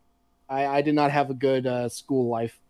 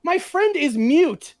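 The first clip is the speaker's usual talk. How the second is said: outraged protest; 13 semitones higher, 3 dB louder than usual. Retold or imitated. To the teacher, defending a mute friend, outraged and high. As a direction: stretch out the disbelief, voice up high. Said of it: "And I'm like".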